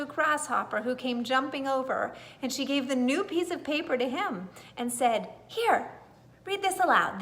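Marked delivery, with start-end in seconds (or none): 5.5-7.2 bright prompt. A narrator's reading. Old lady to grasshopper.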